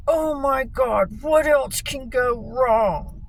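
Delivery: high, slow, drawn-out mock wail; whiny imitation of a panicking dad; mock freaking out. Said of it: "like, losing his shit".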